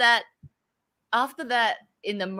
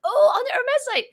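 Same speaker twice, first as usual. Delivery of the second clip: high, loud "oh" of sudden realization; suddenly getting it, pitch jumping up; surprised realization; 4 dB louder than usual. Retold or imitated. Realizing her mistake.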